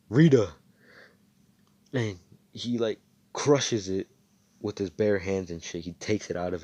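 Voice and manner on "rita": shouted spell name. Retold or imitated. Hero calls out spell name.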